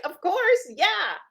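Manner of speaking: high, loud burst of affirmation; delighted reply. Retold or imitated. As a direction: cheer them on, elated and emphatic. Retold delighted reply to client's success.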